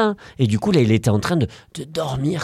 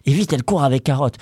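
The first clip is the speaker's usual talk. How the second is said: ordinary delivery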